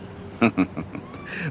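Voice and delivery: quiet breathy self-satisfied laugh; soft chuckle. Acted. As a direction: chuckle at your own joke. Rasputin laughs at his joke.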